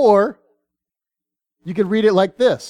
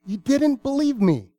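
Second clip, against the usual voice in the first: mild exasperation; 5 semitones higher, 5 dB quieter than usual. Retold or imitated. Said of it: "And Jesus says".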